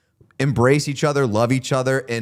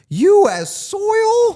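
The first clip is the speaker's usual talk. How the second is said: high, loud, drawn-out exclamation; amazed emphasis; 6 dB louder, much slower than usual. Real, not acted. Co-host marveling at the World Cup, loud and amazed. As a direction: stretch it out in soaring amazement.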